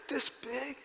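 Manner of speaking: put-on soft, timid child asking "This big?"; small scared voice, "This big?" barely voiced; trembling scared child. Son timidly showing the bug's size, soft and scared. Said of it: "He's, like, shaking and trembling".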